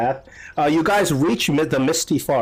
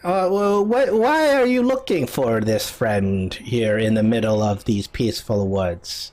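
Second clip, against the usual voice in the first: put-on old woman's questioning, pitch sinking at the end; old lady; 4 semitones lower than usual. Old lady asks why they seek a friend, old lady voice. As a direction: question them as an old hut dweller. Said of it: "this old lady comes out".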